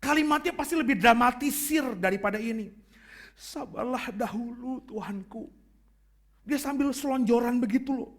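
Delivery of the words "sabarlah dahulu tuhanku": slow, soft pleading, fading to a whisper on "Tuhanku". Shown as a demonstration. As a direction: beg softly, face on the ground. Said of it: "Pasti memelas deh". (memelas, pelan dan lirih)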